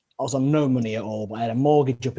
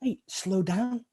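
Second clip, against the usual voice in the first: co-worker; 7 semitones higher, much slower than usual. Retold or imitated. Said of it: "they were like".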